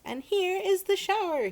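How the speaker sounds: loud, high triumphant shout; boy shouting with the hose. A narrator's reading. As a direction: bellow it as you spray. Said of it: "he shouted".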